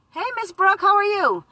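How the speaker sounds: high, bright hello; sudden high greeting to a fan; cheery greeting. Real, not acted. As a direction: greet a viewer happily. Greeting a viewer in chat.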